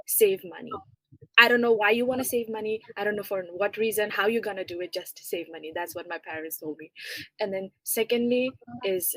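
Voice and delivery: normal talking voice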